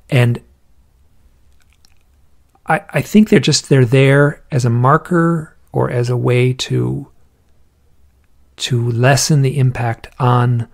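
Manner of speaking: ordinary talk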